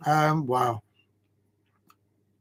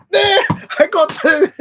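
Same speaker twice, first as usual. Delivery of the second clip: high, loud yelp of triumph; crowing over the second header; thrilled shout; 9 dB louder, slower than usual. Real, not acted. Streamer celebrating two headers for his viewers, high excited yell.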